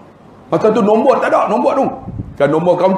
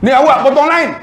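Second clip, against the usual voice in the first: higher, louder scolding rebuke; scolding clerk; 9 semitones higher, 4 dB louder than usual. Retold or imitated. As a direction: snap at the old man for cutting the queue. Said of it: "Dia marah pakcik tu". (kerani marah pakcik potong barisan)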